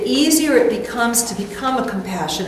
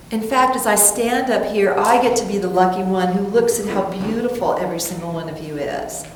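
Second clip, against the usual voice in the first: ordinary talk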